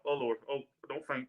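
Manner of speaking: normal talking voice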